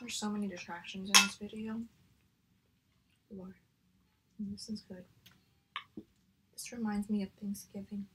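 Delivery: talking between bites; slightly muffled by food; chewing while talking. Real, not acted. Seen 2: Holds spoon and bowl; lips closed, chewing between phrases.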